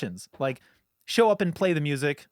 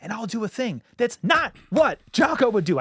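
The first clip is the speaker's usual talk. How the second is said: ordinary talk, nothing marked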